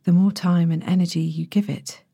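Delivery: ordinary delivery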